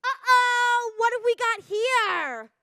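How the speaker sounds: put-on high and loud, a nurse mom calling out a kid; loud mock announcement. Mom at a lice check calling out her own kid, loudly. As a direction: broadcast it to the whole line. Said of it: "really, really loudly go".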